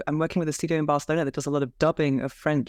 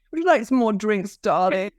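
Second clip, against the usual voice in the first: posh madame voice; 4 semitones higher than usual. Retold or imitated. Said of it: "making all these different voices".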